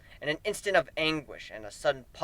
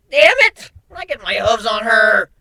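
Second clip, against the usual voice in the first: much higher, much louder angry yell; furious outburst; 6 semitones higher, 11 dB louder than usual. Acted. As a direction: explode in rage.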